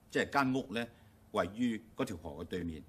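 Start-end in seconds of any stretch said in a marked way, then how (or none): none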